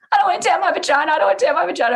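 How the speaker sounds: high, loud, fast panicked thought; panicked inner thought. Retold or imitated. Mother in labour panicking about tearing, high fast panic. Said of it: "I remember thinking".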